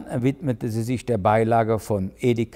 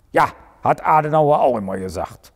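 brummend; 4 semitones higher, 4 dB louder than usual. A narrator's reading. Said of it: "brummte Prick in den Hörer".

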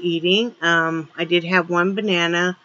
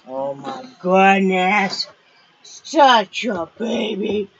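slow, drawn-out cooing; much slower than usual. Real, not acted. Owner cooing over her dog, slow baby talk. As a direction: croon slowly at the puppy.

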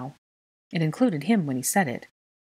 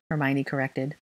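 ordinary delivery

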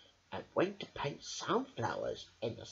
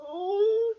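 high sobbing oh; little sob; 9 dB louder than usual. Acted. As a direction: end on a pitiful cry. Teddy ends song.

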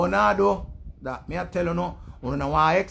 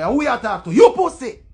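mock angry retort; 3 semitones higher, 8 dB louder than usual. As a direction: explode at the person talking back. Acts out man's angry retort.

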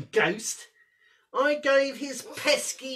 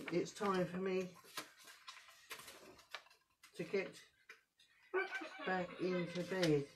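softly, low, drowsy; sleepy and quiet; 6 semitones lower, 12 dB quieter, faster than usual. Acted. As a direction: settle down like you're nodding off. Scrooge sleepy going to bed.

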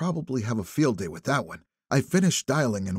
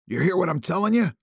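shouting; 7 semitones higher, 4 dB louder, faster than usual. A narrator's reading. Drunk man shouting.

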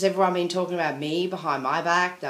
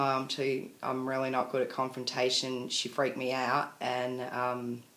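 ordinary delivery

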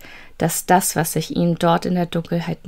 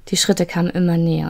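ordinary delivery

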